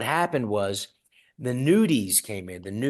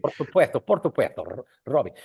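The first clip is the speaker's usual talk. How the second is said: Castilian lisp on por supuesto, said "por suputhto"; lightly mimicking a Spanish comedian; lisping Spanish accent. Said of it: "with the Spanish lisp".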